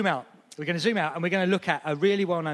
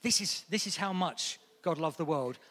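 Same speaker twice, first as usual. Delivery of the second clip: ordinary talk, nothing marked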